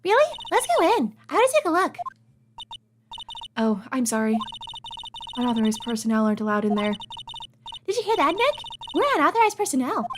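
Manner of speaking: put-on high girly voice. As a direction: voice Maya pleased with herself. Maya eager, high voice.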